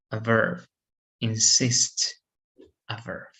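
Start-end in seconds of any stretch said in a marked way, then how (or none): 1.2-2.1 "Insist" stressed on the last syllable, in-SIST; second-syllable stress. Shown as a demonstration. Teacher demonstrating a verb, "Insist" stressed on SIST. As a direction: put the weight on SIST in "Insist". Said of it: "if you stress the second syllable".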